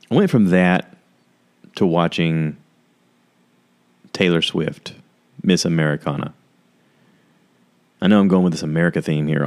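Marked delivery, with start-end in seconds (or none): none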